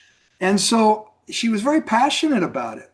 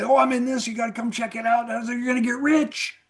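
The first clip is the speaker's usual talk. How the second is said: lightly hammed-up eager recruiter, higher and fast; mocking pitch caller; 6 semitones higher than usual. Mimicking network marketers who phone you up, lightly hammed-up hype. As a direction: tease the hype of a salesman calling a friend. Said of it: "they call you up and say".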